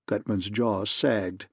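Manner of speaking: usual speaking voice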